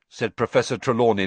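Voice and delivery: usual speaking voice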